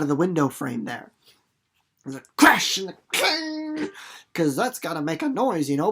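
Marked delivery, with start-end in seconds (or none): 2.0-3.9 high, excited storytelling. Shown as a demonstration. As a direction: bring the crash to life. Acting out jailbreak noise.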